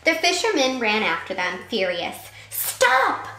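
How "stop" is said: high, loud command shout; sharp high yell. A narrator's reading. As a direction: yell to halt the runaway. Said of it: "he yelled at May May".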